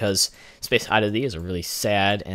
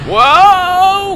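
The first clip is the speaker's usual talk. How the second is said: high, very loud whoa; startled shout; 15 dB louder than usual. Real, not acted. Player reacting to a near-disaster at launch, startled shout. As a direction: cry out in real alarm. Startled as rocket nearly explodes.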